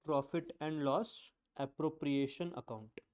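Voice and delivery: very slow, one word at a time; slow dictation. Shown as a demonstration. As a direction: spell it out for their notebooks. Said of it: "Write down". (धीरे-धीरे लिखवाना)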